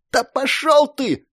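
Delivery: high, drawn-out angry snap; furious bark. A narrator's reading. Girl pushing away the man, furious bark. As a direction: bark it to shove him off. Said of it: "рявкнула девка".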